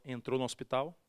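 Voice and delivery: ordinary talk, nothing marked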